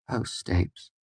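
softly. A narrator's reading. Mayor comforts his crying servant, soft and tender. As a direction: say it gently, almost breathing it. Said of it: "he said softly".

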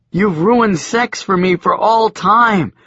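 high, slow, loud lament; high drawn-out complaint; dismayed Joshua. A narrator's reading. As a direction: wail it slowly in dismay. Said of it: "Joshua said".

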